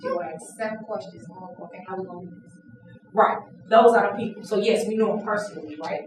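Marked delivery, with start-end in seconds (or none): 0.0-2.5 soft, grumbling under the breath; muttered aside. Retold or imitated. Annoyed colleague grumbling about Danielle on the call. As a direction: complain quietly so no one hears. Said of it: "when you get on the call".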